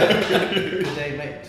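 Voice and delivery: loud, laughing British "good day, mate"; British accent on request. Shown as a demonstration. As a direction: belt it out in a UK accent. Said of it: "in your best U K accent".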